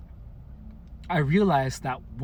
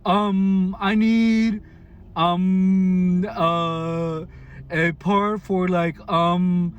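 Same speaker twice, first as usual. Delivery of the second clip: loud, halting mock customer; mocking hesitation; 3 semitones higher, 5 dB louder, much slower than usual. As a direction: drag out the ums to insult him. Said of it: "as if implying that I was stupid".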